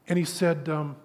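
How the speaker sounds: ordinary delivery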